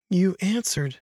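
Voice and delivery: a hint of a soft, patient little girl; soft ghost girl. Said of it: "her voice was the same as the one that had called his name".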